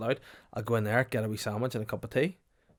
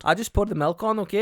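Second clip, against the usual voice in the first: loud, higher brusque cafe worker voice; cafe lady; 10 semitones higher, 9 dB louder than usual. Retold or imitated. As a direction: bark it like a gruff Edinburgh cafe lady.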